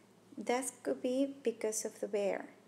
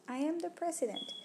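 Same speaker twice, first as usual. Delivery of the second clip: mock-earnest high quip; sarcastic claim, not meant; insincere; 6 semitones higher, faster than usual. Shown as a demonstration. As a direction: say it like you don't mean it.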